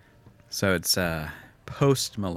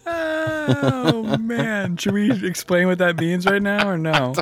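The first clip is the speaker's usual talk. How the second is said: high, loud, amused exclamation; high-pitched helpless amusement; amused outburst; 12 semitones higher, 6 dB louder, slower than usual. Real, not acted. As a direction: squeal it out after cracking up. Recovering from shared laughing fit.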